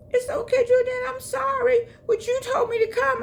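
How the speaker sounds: very high, loud pleading friend voice; consoling friend. Retold or imitated. Friend in the car apologising to the narrator, high and pleading.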